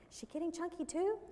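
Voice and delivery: put-on high-pitched small child voice; little girl. Little girl points at another woman and asks, child voice. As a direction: pipe up like a curious little kid. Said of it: "the little girl pointed to me".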